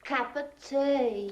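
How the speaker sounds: Cockney vowels. Acted. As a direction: run "of" into "cup", broaden "tea". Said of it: "Can't you hear the difference?".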